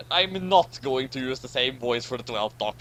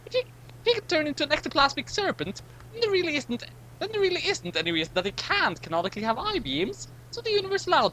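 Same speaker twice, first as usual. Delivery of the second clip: high, fussy narrator-character voice; high character voice musing aloud; high-voiced character; 14 semitones higher than usual. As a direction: pitch it way up and fuss over the logic.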